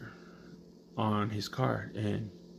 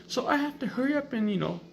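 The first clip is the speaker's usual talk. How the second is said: mock crying TV girl; 14 semitones higher, 3 dB louder than usual. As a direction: parody a melodramatic sob story. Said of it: "And she's crying".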